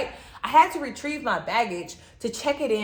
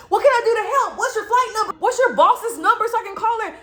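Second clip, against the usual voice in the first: high, louder, rushed worried mother; worried mom; 13 semitones higher, 6 dB louder than usual. Retold or imitated. Mom on the phone with her crying daughter, frantic. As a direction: fire off the questions like a frantic mom. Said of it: "My mom's like".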